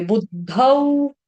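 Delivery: बुद्धौ drawn out slowly on a full au; au ending. Shown as a demonstration. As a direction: stretch the final au glide of बुद्धौ. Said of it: "दो मात्राएं तो औ बोलेंगे". (बुद्धौ का औ लंबा खींचकर)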